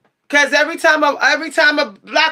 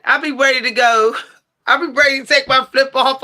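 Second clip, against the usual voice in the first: ordinary talk, nothing marked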